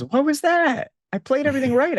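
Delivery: bewildered new player. Retold or imitated. New player wailing at the board wipe in outrage. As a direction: squeal like a beginner who just lost everything. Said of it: "and then the player goes".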